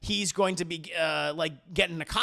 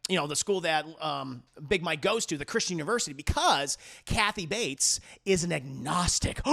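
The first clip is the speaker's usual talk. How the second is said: ordinary delivery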